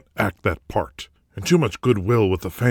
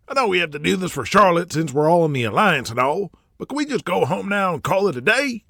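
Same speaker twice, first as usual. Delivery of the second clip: higher, weary grumble of a tired producer; impatient old TV boss; 10 semitones higher than usual. Acted. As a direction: beg to wrap up, worn out. Pops impatient with the taping.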